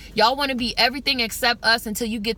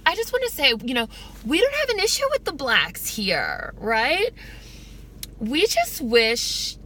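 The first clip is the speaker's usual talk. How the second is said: campy voice swooping high; polite Klan member; 3 semitones higher than usual. Retold or imitated. Skit's Klan member airing his complaint, mock-polite. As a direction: imitate a sweetly polite bigot, pitch leaping up. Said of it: "This is what he was saying in the skit".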